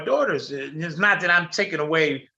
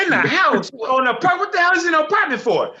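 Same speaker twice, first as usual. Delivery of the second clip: outraged; 8 semitones higher, faster than usual. Retold or imitated. Father outraged at boyfriend inside.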